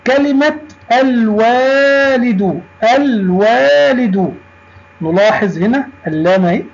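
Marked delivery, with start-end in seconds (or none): 1.0-4.3 الوالد slow and high, l held; pronounced lam. Shown as a demonstration. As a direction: say الوالد as alll-waalidu, vowels stretched. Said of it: "نطقناها وكتبناها".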